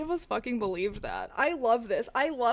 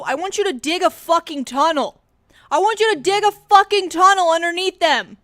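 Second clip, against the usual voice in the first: growing louder toward a shout; building rant; 5 semitones higher, 10 dB louder than usual. Real, not acted. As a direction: build the demand into a yell.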